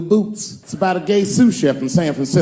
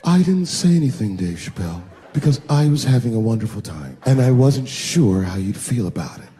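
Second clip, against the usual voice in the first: sultry voice; 5 semitones lower than usual. Retold or imitated. Dance partner answering the comic, low and sultry. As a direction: coo it like a seductress. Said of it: "Then I heard that sultry voice".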